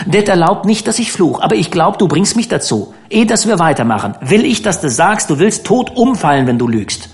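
put-on a touch of stern boyish challenge; insistent boy. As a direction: make him swear it. Dan demands an oath.

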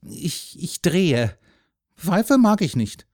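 put-on faltering boy gaining voice; timid. A narrator's reading. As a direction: stammer into a shy admission. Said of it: "sagte zaghaft".

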